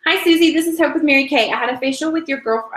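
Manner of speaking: cheery sales-call greeting; reading a script. Shown as a demonstration. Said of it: "I'll just read this one".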